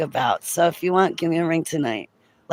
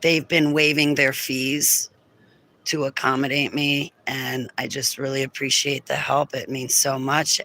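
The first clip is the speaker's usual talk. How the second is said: normal talking voice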